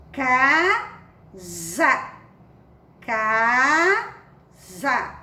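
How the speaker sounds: "Casa" esticado em "caaa... za"; leitura silabada. Shown as a demonstration. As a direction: leia "Casa" em duas sílabas, com Z vibrante. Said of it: "um S com a za". ("Casa" silabado, com som de Z)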